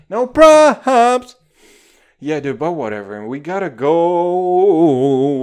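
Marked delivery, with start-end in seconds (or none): 0.1-1.4 high, loud mock shout; No props! yelled high and loud. Shouting over music. 3.2-5.4 loud, slow, drawn out; slow loud announcer-ish call. Pretend. Sudden loud slow line.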